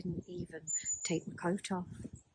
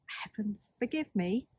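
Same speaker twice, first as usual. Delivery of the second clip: normal talking voice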